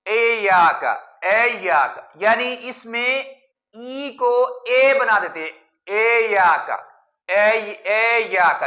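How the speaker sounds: اے یا کا with the first vowel as English a, ay-ya-ka; e instead of i. Shown as a demonstration. Teacher imitates the majhul mistake, ay-ya-ka. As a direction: say ایاک starting with ay, not ee. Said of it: "مجہول پڑھنا ٹھیک نہیں ہے".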